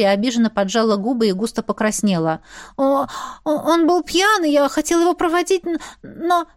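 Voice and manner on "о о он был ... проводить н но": high-pitched, hesitant excuse; flustered stammer. A narrator's reading. Mary, blushing, explains being with Etienne, flustered stammer. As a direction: trip over the words, embarrassed, much higher.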